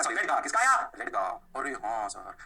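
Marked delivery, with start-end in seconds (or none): 1.6-2.3 low pupil voice; student realisation. Acted. Teacher playing students who just caught on, student realisation. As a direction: drop low for a pupil catching on.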